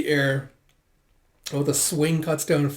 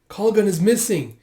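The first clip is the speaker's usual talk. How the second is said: higher, louder alarmed report; 7 semitones higher, 6 dB louder than usual. Retold or imitated. Companion reporting that someone is missing, alarmed. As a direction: raise the alarm urgently.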